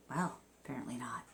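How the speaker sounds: quick whispered wry remark; whispered aside. Real, not acted. Host mocking the father's quote in a quick whisper. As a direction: whisper it fast like a sarcastic aside.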